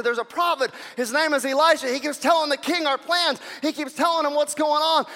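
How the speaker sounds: high, urgent acted complaint; exasperated report. Soldier explaining leaked plans to enemy leader, exasperated.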